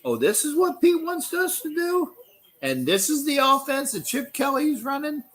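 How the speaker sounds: mock inner thoughts of a tired veteran; imitating the quitter. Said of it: "he probably figured out".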